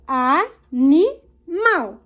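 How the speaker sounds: animal in three slow beats with pauses; teacher breaking "animal" into its syllables; separated syllables. Shown as a demonstration. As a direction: say "animal" as a, ni, mal, apart. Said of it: "nós vamos separar".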